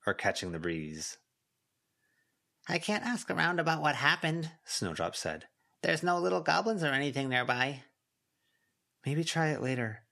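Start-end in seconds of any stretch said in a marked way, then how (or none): 2.7-4.5 high, small spirit voice; Snowdrop voice. A narrator's reading. Snowdrop answering Avery in a high voice. 5.8-7.8 high, small spirit voice; Snowdrop voice. A narrator's reading. Snowdrop answering Avery in a high voice.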